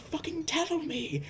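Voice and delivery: high, drawn-out imitation of an outburst; exasperated high-pitched order; acted angry demand.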